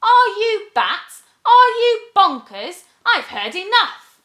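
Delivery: very high, loud outraged retort; indignant witch. Acted. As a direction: snap back in a huff. Witch in a huff.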